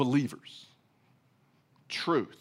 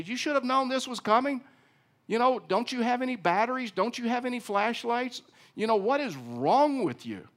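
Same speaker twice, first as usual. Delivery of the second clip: high, sneering put-down of a neighbour; mocking scold; 8 semitones higher, 3 dB louder than usual. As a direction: taunt him like a know-it-all. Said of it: "Do I degrade him? Do I demean him?".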